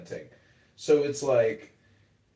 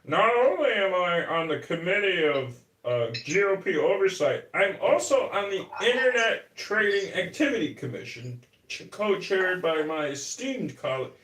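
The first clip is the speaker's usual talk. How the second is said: pompous politician voice, pitched a bit higher; LaHood; 7 semitones higher than usual. Retold or imitated. Mocking the congressman's clip about his committees, pompous politician voice. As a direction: read it like a self-important congressman. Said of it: "There's a clip of him saying".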